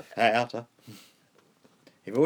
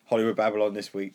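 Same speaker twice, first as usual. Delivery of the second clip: usual speaking voice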